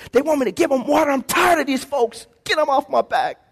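a hammed-up, fed-up grumble; mock exasperated complaint. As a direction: complain to God about the people like you've had enough. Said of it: "was mad at the people".

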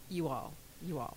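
"you all" as two words, low and soft; drawn-apart "you all". Shown as a demonstration. Showing how y'all changed in Pittsburgh, soft two-word "you all". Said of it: "it went from y'all to".

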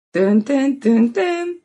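ordinary talk

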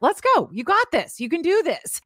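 high-pitched, peppy encouragement; inner cheerleader. Acted. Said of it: "like my own cheerleader inside".

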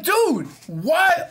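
high incredulous whine; exasperated disbelief. Retold or imitated. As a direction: ask in baffled outrage. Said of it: "I'm like".

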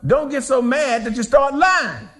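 ordinary talk, nothing marked

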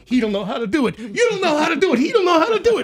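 rising high, laughing mock chant; chorus of taunting coworkers. Coworkers popping up like groundhogs, chanting a high mocking taunt. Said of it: "people going".

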